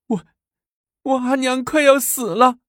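put-on very high, tearful little girl; sobbing child. A narrator's reading. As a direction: stammer it out through tears. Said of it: "只是嘤嘤地哭着". (小女孩嘤嘤地哭诉)

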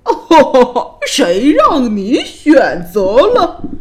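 loud whinnying laugh into slow bellow; mock her loudly, drawn out like a neigh; horse-like neighing king. A narrator's reading. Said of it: "声音听起来像马叫一样".

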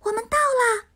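high, bright pixie announcing; a little pixie cheering arrival. Acted. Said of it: "小精灵说".

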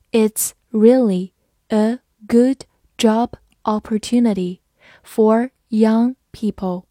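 slow, word by word; slow read-along. Shown as a demonstration. Teacher leading learners through the example, slow and measured. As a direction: separate each word for learners to follow. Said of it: "好，慢慢来读".

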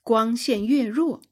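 plain reading voice

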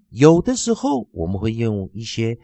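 ordinary talk

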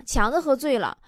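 normal talking voice

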